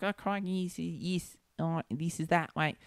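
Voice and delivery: lightly done Cockney slipping Australian; a loose Cockney with Aussie drift; Cockney accent. Retold or imitated. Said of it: "fucking Cockney accent".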